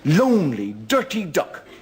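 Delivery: slow comic drawl. Acted. Drawn-out joke setup.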